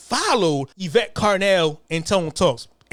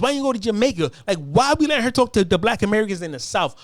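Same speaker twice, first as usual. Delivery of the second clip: usual speaking voice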